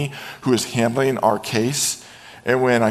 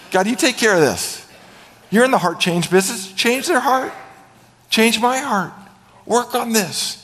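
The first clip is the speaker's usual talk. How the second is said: high-pitched, urgent acted plea; pleading prayer; 9 semitones higher than usual. Believer telling God on the boss, pleading. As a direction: petition God like a tattling complainant.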